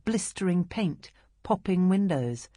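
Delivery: ordinary delivery, nothing marked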